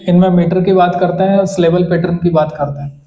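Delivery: ordinary talk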